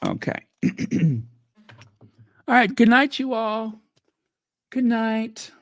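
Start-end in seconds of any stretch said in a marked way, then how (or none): none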